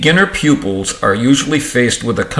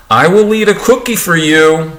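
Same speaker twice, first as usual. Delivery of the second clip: put-on high, louder storybook child; primer child; 7 semitones higher, 5 dB louder than usual. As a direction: read it as a little girl to her doll. Child speaking to doll in primer.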